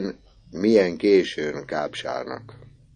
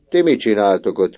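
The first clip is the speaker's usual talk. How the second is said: louder, higher call from the window; 4 semitones higher, 7 dB louder than usual. Acted. Teacher calls out of the window to the boys, louder. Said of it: "kiáltott ki".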